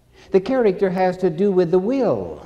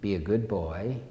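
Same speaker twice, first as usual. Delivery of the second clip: low, soft, mildly insistent mother; hushed, lower coaxing; 5 semitones lower, 9 dB quieter than usual. Retold or imitated. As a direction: lightly voice a mother quietly pressing her child. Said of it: "But Mother is insistent".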